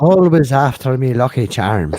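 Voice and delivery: bigger, punched-up Irish leprechaun; playful Irish accent breaking toward laughter. Acted. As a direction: belt the opening in a mock Irish brogue. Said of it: "it's a standard Irish accent".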